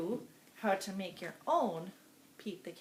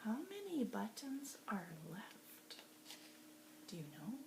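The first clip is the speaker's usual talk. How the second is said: whisper; 2 semitones lower, 11 dB quieter than usual. Shown as a demonstration. Teacher quizzes kindergarten softly.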